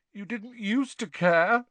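high, slow, miserable ghost; sulky miserable girl. A narrator's reading. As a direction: whine it miserably. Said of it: "said Myrtle miserably".

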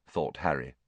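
plain reading voice

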